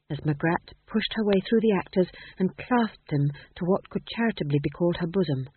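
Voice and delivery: usual speaking voice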